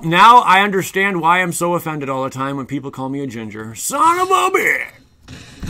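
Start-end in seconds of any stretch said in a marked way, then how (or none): 3.8-5.7 much higher, drawn-out hammed-up cry; mock outrage. Streamer hams up outrage over being called a ginger. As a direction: ham up a betrayed yelp.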